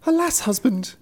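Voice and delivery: very high wife voice; driver's wife. A narrator's reading. Wife answering her husband, high dismayed voice. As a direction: lament in a high-pitched voice. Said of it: "she answered".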